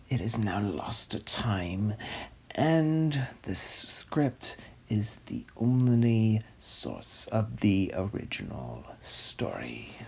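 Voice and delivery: soft dramatic whisper. Acted. Host revealing the lost film's only source, whispered. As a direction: whisper it like a secret. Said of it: "dramatic whisper".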